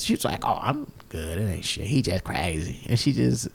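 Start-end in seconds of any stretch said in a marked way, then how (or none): 0.4-2.8 low, soft, imitated shrug-off; quiet, casual mock indifference; nonchalant. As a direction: brush it off, imitating an unbothered woman. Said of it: "like, nonchalant".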